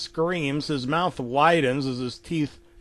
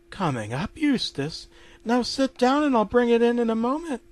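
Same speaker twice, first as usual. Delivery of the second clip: lightly voiced higher obliging wife; Muriel obliging; 9 semitones higher than usual. Wife promising to bring Eustace his dinner, obliging. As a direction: answer agreeably, rising into a higher voice.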